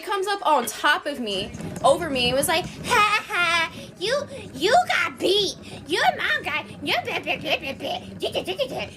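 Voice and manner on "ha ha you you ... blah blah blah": high, drawn-out mocking kid voice; taunting little girl. Little girl gloats over the beating, taunting. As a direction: imitate a gloating brat dissolving into gibberish.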